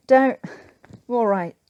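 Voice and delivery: loud, strained gasps after a coughing fit; choking outburst. Acted. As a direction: force it out while still choking. Choking on terrible tea.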